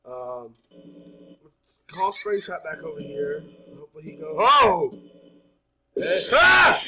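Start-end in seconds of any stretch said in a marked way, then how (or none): 4.4-6.8 sudden frightened yell, much louder and higher; real startled shout of fright; jumpscare yelp. Real, not acted. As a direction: yelp as the animatronic lunges. Jumpscare hits the player.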